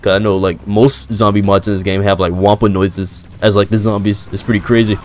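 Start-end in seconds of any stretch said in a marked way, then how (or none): none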